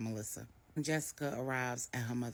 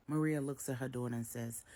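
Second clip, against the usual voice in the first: plain reading voice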